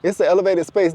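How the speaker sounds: high-pitched, baffled pushback; acted astonished persuading; incredulous reply. Acted baffled reply, selling new world.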